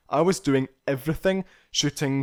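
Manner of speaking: ordinary talk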